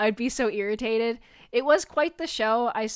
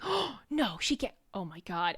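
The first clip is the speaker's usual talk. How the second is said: hushed dismay; 3 semitones lower, 8 dB quieter than usual. Retold or imitated. Retold guy shocked, gasps softly.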